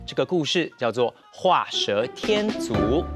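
ordinary delivery

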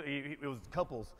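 normal talking voice

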